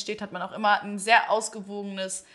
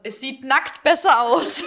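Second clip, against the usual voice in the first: slow, laughing through the words; amused, drawn-out laugh; 3 semitones higher, 7 dB louder, slower than usual. Real, not acted. Mocking quote, laughing.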